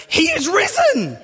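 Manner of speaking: high, cackling witch-like cry; witchy old lady. Retold or imitated. Church old lady pouncing on the preacher, witchy. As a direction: shriek it like a storybook witch. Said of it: "why she sounds like a witch".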